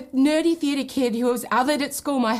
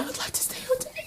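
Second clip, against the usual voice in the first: playful high voice; 7 dB quieter, faster than usual. Acted. Playful reply to crew.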